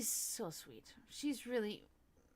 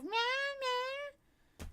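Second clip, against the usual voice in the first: high stretched meow; cat meow. Pretend. Telling viewers about cats on the roof, high meow.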